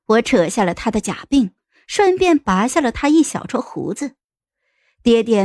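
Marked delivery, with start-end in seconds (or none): none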